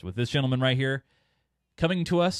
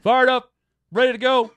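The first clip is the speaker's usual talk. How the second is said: excited; 9 semitones higher, 5 dB louder than usual. Acted. Echoing guest with hype.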